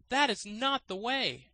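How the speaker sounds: high, distinct voice correcting him; gardener correcting. A narrator's reading. Gardener corrects the student, high and knowing. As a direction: set him straight, a touch smug.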